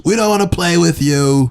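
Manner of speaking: high, teasing rejection; mean cartoon kid. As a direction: brush him off in a high cartoon voice. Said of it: "cartoon character".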